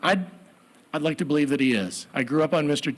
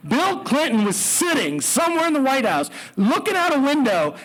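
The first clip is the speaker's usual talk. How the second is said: plain reading voice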